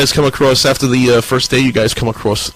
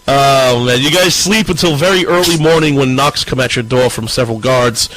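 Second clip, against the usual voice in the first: usual speaking voice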